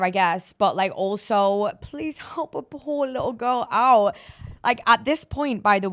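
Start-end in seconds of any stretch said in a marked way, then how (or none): 1.8-4.2 put-on higher, slow little-girl plea; hammed-up helpless girl voice; mock pitiful plea. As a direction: play the helpless poor little girl, half joking. Self-pity after officer's refusal.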